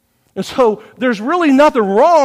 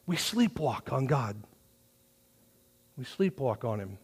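hushed; 9 semitones lower, 15 dB quieter than usual. Real, not acted. Preacher hushes on a point.

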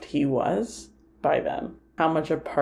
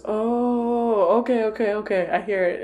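mock sudden understanding, pitched up; retold dawning realisation; 5 semitones higher than usual. Acting her sudden understanding.

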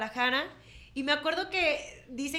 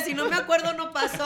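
quoting [name]; 4 semitones higher, 6 dB louder than usual. Retold or imitated. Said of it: "como dijo [name]".

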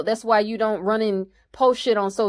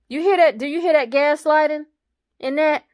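higher, louder "you hear that?"; stunned, calling out what she just heard; worked-up disbelief; 6 semitones higher, 3 dB louder than usual. Real, not acted. Outraged at Tiny's comment.

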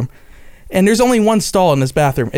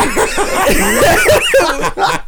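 high burst of laughter. Real, not acted. Storyteller cracks up again.

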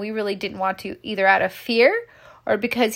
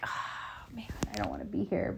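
softer whisper, fast; whispered thought; 12 dB quieter than usual. Retold or imitated. Guest at an unwanted event whispering to herself. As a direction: mutter it under your breath.

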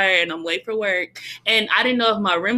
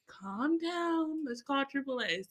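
calm mom; 5 semitones higher, 13 dB quieter, slower than usual. Retold or imitated. Mom calming upset daughter.

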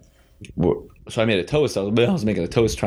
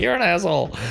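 mock drunk yell; 11 semitones higher than usual. Drunk stranger yelling at him after the toast, mock high shout. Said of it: "It was like".

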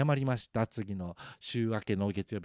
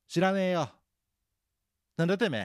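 loud, high re-enacted fighting talk; 強い口調; 7 semitones higher, 6 dB louder, faster than usual. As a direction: pick a fight, rough and forceful. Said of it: "強い口調で喋ったり". (喧嘩腰の強い口調で)

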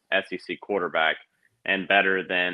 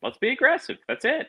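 pitched way up, cheering rally cry; excited. Real, not acted. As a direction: pump up the team, high and eager. Hyping aggressive defense.

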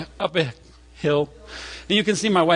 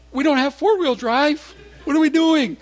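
high, panicky imitation of a boy; alarmed child; 11 semitones higher, 4 dB louder than usual. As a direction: fret loudly in a high kid's voice. Voices son alarmed by road sign.

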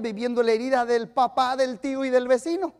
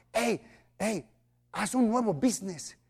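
soft inner voice; 4 semitones lower, 8 dB quieter than usual. Retold or imitated. God's whisper retold.

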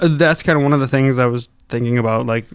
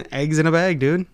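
Wisconsin accent; 3 semitones higher than usual. Shown as a demonstration. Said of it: "showing how bad our accent is".

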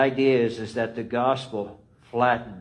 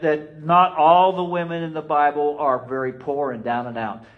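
preaching; 4 semitones higher, 4 dB louder than usual. Acted. Preaching sings the line.

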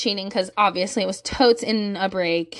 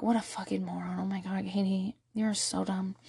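low, soft talking to herself; hushed, deflated self-reproach; muttered self-scolding; 3 semitones lower, 10 dB quieter than usual. Retold or imitated. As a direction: mutter in disgust at yourself. Retells scolding herself in the car.

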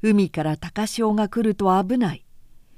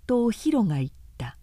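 ordinary delivery

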